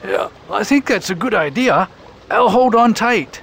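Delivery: high, agreeable tortoise voice; a small creature happily agreeing; Torquil the tortoise. Acted. Tortoise agreeing to Burton's plan, high and eager. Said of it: "he agreed".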